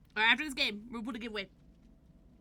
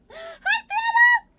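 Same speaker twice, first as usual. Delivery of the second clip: very high, loud greeting; excited shout; 17 dB louder, slower than usual. Real, not acted. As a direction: call the name out with delight. Greeting a viewer.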